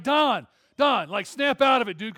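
loud, high pleading call; urgent plea. Retold or imitated. Dave pleading loudly with Don to stop. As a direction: yell to stop a big man charging. Holding back the angry snapper.

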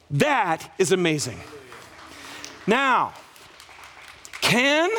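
normal talking voice

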